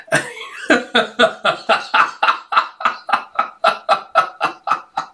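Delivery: long high giggle fit; helpless laughter. Real, not acted. Vlogger cracking up at the dodging line, long giggle fit. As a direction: keep giggling till the breath runs out.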